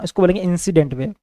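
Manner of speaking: usual speaking voice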